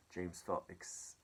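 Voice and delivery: usual speaking voice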